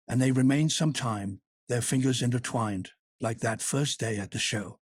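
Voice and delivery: ordinary delivery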